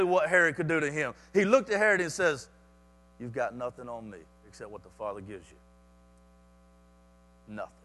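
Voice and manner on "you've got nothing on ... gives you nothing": very low, hushed voice of bold courage; quiet defiance. Acted. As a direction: drop far down, soft and sure. Jesus quietly defies Herod.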